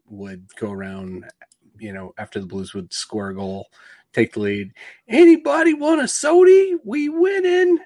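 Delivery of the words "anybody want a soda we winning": high, very loud hawker's call; arena vendor's holler. Retold or imitated. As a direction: holler it like a stadium soda seller. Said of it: "there was a vendor".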